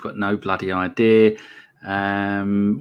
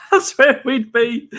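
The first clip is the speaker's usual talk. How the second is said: laughing; 13 semitones higher, slower than usual. Real, not acted. Laughing at a reader's answer.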